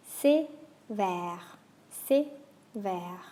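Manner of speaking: "c'est" clipped and sharp, "vert" long and open; short é then long è; é and è contrast. Shown as a demonstration. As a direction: snap the first vowel, open the second. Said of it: "Short and sharp, long and open".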